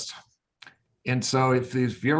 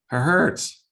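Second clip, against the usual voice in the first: louder and higher, leaning on hurts; emphatic; 6 semitones higher, 5 dB louder than usual. Real, not acted. Speaker stressing to listeners that injections hurt, emphatic. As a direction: punch the line home.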